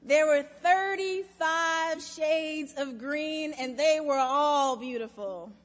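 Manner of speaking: put-on slow, loud, proud child's declaration; emphatic, triumphant little-girl report. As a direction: announce it proudly, word by word. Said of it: "stood up proudly and said".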